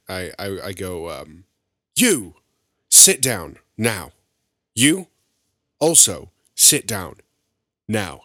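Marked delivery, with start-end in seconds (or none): 2.0-8.2 loud, forceful order to the room; bellowed command to unruly brawlers; commanding yell. Acted. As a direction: yell it in separate, emphatic bursts.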